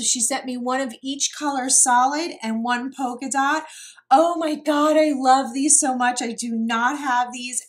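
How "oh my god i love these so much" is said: loud, gushing delight; so excited. Real, not acted. Vlogger gushing over doilies a friend sent, excited. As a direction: burst out with real excitement, louder and higher at the start. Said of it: "I am so excited".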